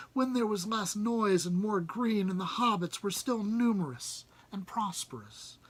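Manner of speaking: usual speaking voice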